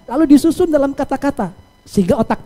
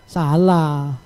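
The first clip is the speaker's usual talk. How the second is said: SALAH low and falling, like a verdict; misleading intonation; 6 semitones lower than usual. Shown as a demonstration. Host testing listeners with an antonym, misleading falling intonation. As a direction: say SALAH as a low, final ruling. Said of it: "intonasi yang kurang tepat".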